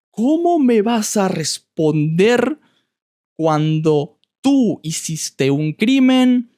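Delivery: mock the angry neighbor blowing up; neighbor exploding. Neighbor blowing up at the tenant, mock angry outburst. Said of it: "él explotó".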